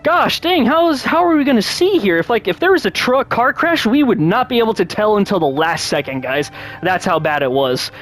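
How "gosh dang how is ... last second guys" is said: high, alarmed retelling of the storm; pitched-up worried rant; retold panic. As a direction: sound spooked by the blinding rain. Panicking about rain on bridge.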